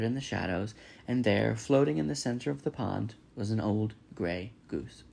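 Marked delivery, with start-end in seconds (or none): none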